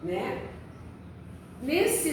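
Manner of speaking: ordinary talk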